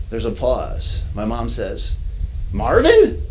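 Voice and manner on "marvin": high, slow incredulous repeat of the name; mother stunned by a familiar name, surprised; surprised disbelief. Retold or imitated. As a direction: echo the name as if it can't be true. Mom hears a familiar name.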